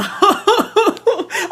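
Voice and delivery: a bright burst of high laughter. Real, not acted. Laughing at her own message, high laugh. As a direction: laugh out loud.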